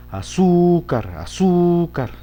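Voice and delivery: azúcar with ZÚ stretched and pitched high; stress on ZÚ. Shown as a demonstration. Teacher showing pupils the right stress of azúcar. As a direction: lean slowly on the zú of azúcar. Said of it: "Sí, allí sí se acentúa".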